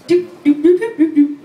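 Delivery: high, loud "doop" noises; imitated dialing beeps. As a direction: mimic phone dial tones with your voice. Imitates coworker's phone being dialed.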